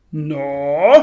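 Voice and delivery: loud, suspicious 'well' of a mock adult; angry grown man. A narrator's reading. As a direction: put on a cross grown man, loudly. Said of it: "ligesom en rigtig voksen og gal mand".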